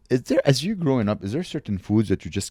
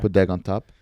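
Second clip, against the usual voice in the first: ordinary talk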